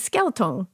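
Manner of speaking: "skeleton" as falling "skel-tone"; child's accented pronunciation. Retold or imitated. Child correcting her parent, "skeleton" as "skel-tone". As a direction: insist on "skeleton" as two syllables, "skel-tone". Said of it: "she would do this accent".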